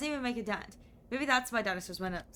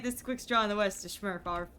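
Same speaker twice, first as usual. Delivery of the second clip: normal talking voice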